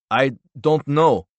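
lightly voiced pressured man; insistent denial. A narrator's reading. Man denies knowledge to intruder.

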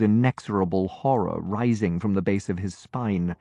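ordinary delivery, nothing marked

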